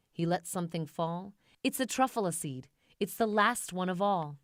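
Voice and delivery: ordinary delivery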